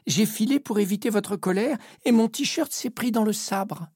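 put-on nervous young sailor stammering; stammering cabin boy. A narrator's reading. Cabin boy explaining to the captain, mild nervous stammer. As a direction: stumble over it, scared. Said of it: "bafouille Pépito".

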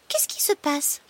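little high-pitched voice; small frog voice. Acted. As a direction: ask it as a tiny frog. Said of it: "demande-t-elle de sa petite voix".

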